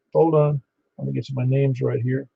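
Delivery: normal talking voice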